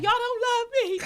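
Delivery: high, loud mock-wounded wail; dramatic whine. Imitating herself whining to friends, high and loud. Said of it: "like, dramatic".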